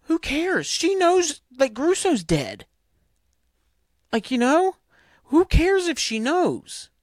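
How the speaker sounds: real fed-up rant; exasperated outburst. Real, not acted. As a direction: let the disbelief burst out in real irritation. Exasperated rant about a character.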